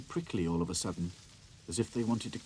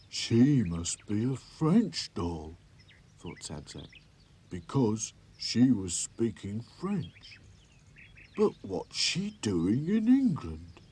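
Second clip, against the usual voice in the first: puzzled toy voice; 6 dB louder, much slower than usual. A narrator's reading. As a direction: think it through aloud, slowly. Sad Sack thinks aloud.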